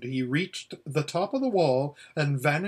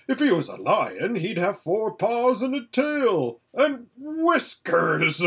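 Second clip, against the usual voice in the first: beginning to laugh; 7 semitones higher, 3 dB louder than usual. A narrator's reading. Horse mocks the idea of a real lion, beginning to laugh. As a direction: build towards laughter, rising higher word by word. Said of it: "Bree began to laugh".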